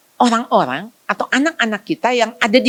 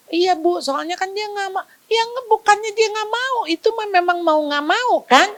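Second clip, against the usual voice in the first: flustered father; 10 semitones higher than usual. Retold or imitated. Father answers the speaker.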